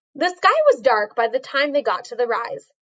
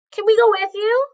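put-on eager girl voice; 9 semitones higher, faster than usual. A narrator's reading. Annie eager to join.

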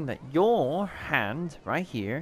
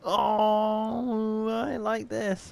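delighted laughing; 8 semitones higher, much slower than usual. Real, not acted. Good cards dealt.

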